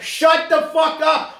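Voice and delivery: loud, high, slow shout; hammed-up furious outburst; exasperated scream. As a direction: roar to silence an imagined chatterbox. Cuts off the imagined fans.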